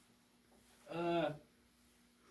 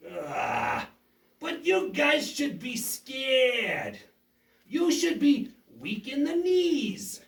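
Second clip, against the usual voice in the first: slow taunting villain; 3 semitones higher, 4 dB louder, much slower than usual. Acted.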